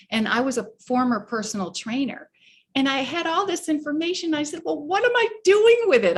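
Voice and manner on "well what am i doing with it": high, loud, quick cry of frustration; frustrated outburst. Retold or imitated. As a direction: throw the question up in exasperation. Exasperated self-quote.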